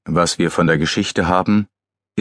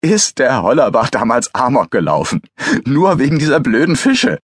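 higher, animated mocking question; amused outburst; 6 semitones higher, 3 dB louder than usual. A narrator's reading. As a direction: recall it with incredulous relish. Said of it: "Er lachte auf".

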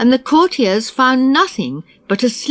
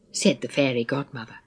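plain reading voice

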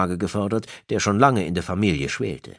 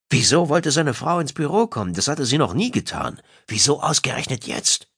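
higher, agitated inner questioning; a touch of panic, pitched up; mild alarm; 9 semitones higher than usual. A narrator's reading. Husband panics inwardly about his wife visiting, mildly alarmed.

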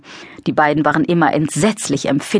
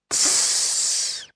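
soft unvoiced hiss; hissing tss; 7 dB quieter than usual. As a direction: let out a short hiss. Same noise again. (leises Zischen)

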